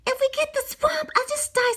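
put-on high, worried child's voice; scared kid voice. A narrator's reading. A kid fearing the swamp, high and worried. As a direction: squeak it out like a frightened kid. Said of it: "said one kid".

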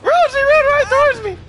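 very high, loud shriek of disbelief; high-pitched gamer yelp; excited disbelief. Real, not acted. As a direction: squeal it in delighted shock.

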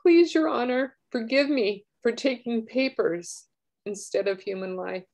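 high, loud, tearful plea; crying while reading. Real, not acted. Reading the letter's plea to the judge, openly weeping. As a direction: let the sob break through the plea. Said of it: "I didn't think I'd get emotional reading this".

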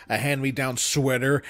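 ordinary delivery

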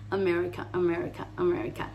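"America" lightly, first A reduced to "uh"; "America" in relaxed English, final A gentle; soft closed vowels. Shown as a demonstration. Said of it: "La A final también suavecita".

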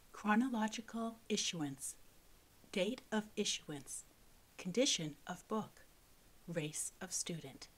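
soft, quiet reading of a list; hushed reading. A narrator's reading. Narrator reading a school book's stamp, hushed. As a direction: read the chart headings under your breath.

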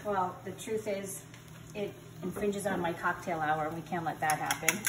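ordinary talk

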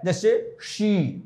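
usual speaking voice

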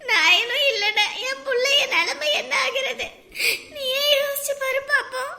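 weepy complaint; tearful lament. Acted. Mother telling Maha of all the taunts she bore, crying. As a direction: plead through tears. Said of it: "அப்படியெல்லாம் பேசாதீங்க".

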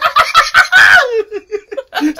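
high shrieking laugh; loud laughing shriek. Real, not acted. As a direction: burst into a screaming laugh. Laughing hard at joke.

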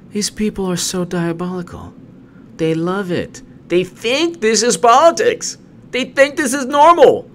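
high, loud indignant shouting; outraged rant. Real, not acted. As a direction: blast the hypocrisy. Ranting in outrage.

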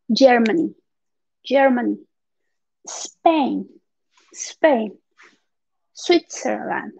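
Germany, Spain, Switzerland, each said apart with a falling end; pronunciation model. Shown as a demonstration. Teacher reviewing countries with students, pronunciation model. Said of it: "para que se acuerden de la pronunciación".

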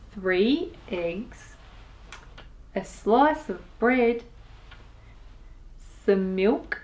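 plain reading voice

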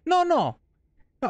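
usual speaking voice

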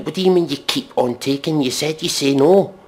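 ordinary delivery

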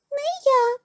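high-pitched mouse voice; refusing little mouse. A narrator's reading. Mouse refuses to help thresh, high and squeaky. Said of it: "отказує одне мишеня".

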